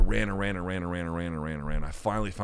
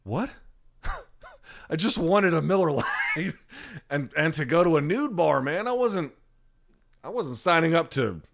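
high-pitched protest through laughs; hammed-up bewildered whine with laughter; incredulous protest; 8 semitones higher than usual. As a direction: plead innocence in comic disbelief. Acts his baffled, laughing protest.

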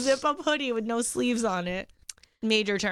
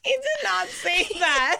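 laughing; 6 semitones higher, slower than usual. Real, not acted. Laughing denial.

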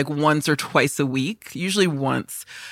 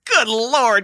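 exasperated exclamation; 6 semitones higher, 3 dB louder than usual. Retold or imitated. Repeating her exasperated reply.

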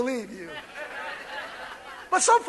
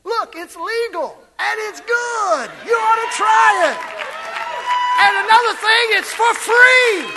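higher and louder, building to a cry; rising sales pitch; 7 semitones higher, 4 dB louder than usual. Real, not acted. Pastor urges the church, building. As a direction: sell it like a street vendor.

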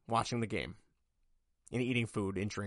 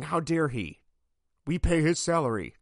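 imitating an indignant fan, louder and higher; mock outraged fan; 5 semitones higher, 6 dB louder than usual. Podcaster mocking angry Bears fans: mock outraged, louder. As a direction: mimic the offended fans with a sneer of outrage. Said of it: "Bears fans out here lost their mind".

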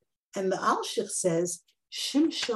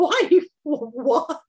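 usual speaking voice

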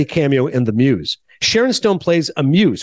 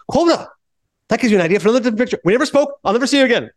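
high, rapid-fire offended director; excitable Marty Scorsese; 7 semitones higher, faster than usual. Retold or imitated. Director cutting off Albert Brooks after a rebuff, excitable. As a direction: fire it off in a high, huffy rush. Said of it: "then Marty walks out".

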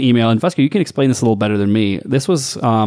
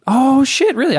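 surprised; 14 semitones higher, slower than usual. Real, not acted. Surprised at the aggregate news.

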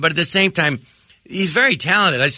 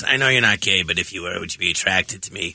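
Harvey Fierstein impression; 11 semitones lower, 4 dB quieter than usual. Comic imitating Harvey Fierstein asking a guest, low-voiced. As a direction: lower voice, like a talk show host asking his guests. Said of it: "It's a really good impression of Harvey Firestein".

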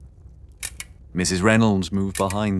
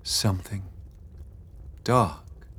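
soft; 8 dB quieter than usual. Acted. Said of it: "Beatrix spoke softly".

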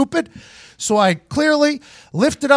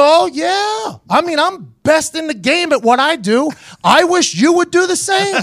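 cocky retort; 7 semitones higher than usual. Retold or imitated. Mocking reply to boss.

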